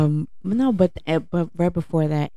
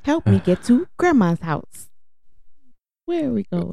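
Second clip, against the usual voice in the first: Dora voice; 8 semitones higher than usual. Pretend. Mocking Dora the Explorer.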